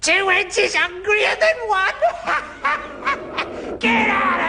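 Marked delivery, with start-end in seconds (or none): 2.0-3.7 long high crone laugh; high witch cackle. Acted. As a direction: cackle gleefully like a hag. Cackles at own joke.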